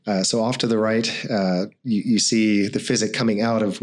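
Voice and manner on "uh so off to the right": ordinary talk, nothing marked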